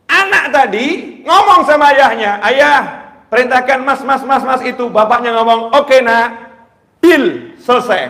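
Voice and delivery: high loud storytelling from the pulpit; preaching at full force. Acted.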